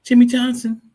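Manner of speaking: mock tough-guy name call, high and loud. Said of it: "That's like saying tough guy".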